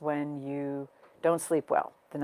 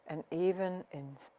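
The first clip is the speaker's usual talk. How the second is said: guided meditation. Real, not acted. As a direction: guide them gently inward, unhurried, letting each phrase settle. Leads a breathing meditation.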